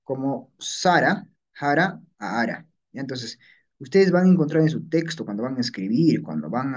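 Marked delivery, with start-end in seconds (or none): 0.6-1.3 Sara with a clear s, drawn out slowly; original S form. Shown as a demonstration. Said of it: "de la S a la J". 1.5-2.0 Jara with a fricative h onset; J variant. Shown as a demonstration. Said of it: "de la S a la J". 2.4-2.7 Ara with no consonant first; dropped onset. Shown as a demonstration. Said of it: "de la S a la J".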